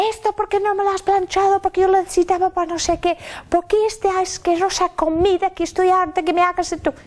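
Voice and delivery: put-on high, fast whiny teenager complaining; hijos quejosos. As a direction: mimic a demanding kid whining at a parent. Imita a los hijos quejándose. (imitando a un hijo quejica, agudo y rápido)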